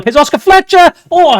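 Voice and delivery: loud, high rising commentary; excited. Real, not acted. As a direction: call it out with building hype. Commentating a chance.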